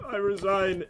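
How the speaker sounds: mock tearful surrender; imitated tearful resignation. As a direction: concede like a sobbing child. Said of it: "the kid puts out this trembling hand".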